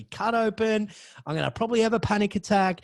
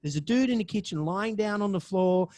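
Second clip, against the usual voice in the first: ordinary delivery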